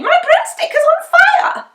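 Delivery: high, loud panicked cry; a witch in a fright over her broom; alarmed witch. A narrator's reading. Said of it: "said Winnie".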